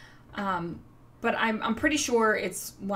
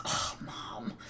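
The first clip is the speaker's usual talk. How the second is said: soft, airy eye-rolling groan; exasperated daughter; 8 dB quieter than usual. Retold or imitated. Mother imitating her embarrassed daughter, exasperated whisper-sigh. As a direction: imitate an embarrassed kid sighing the words. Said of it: "rolls her eyes and goes".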